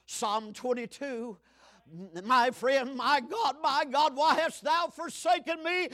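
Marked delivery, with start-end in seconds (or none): 2.2-5.9 rising preacher's cry, louder; preaching at full force. Acted. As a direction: call it out from the pulpit.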